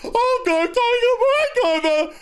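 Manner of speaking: very high, stretched falsetto okay; mock dim servant voice, way up high; squeaky chauffeur. Mocking chauffeur agreeing, falsetto.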